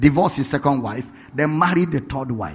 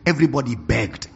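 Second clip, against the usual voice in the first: ordinary talk, nothing marked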